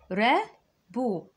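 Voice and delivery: رابو very slow with falling end; drawn out. Shown as a demonstration. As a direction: say رابو slowly. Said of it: "يعني شراب". (رابو ببطء)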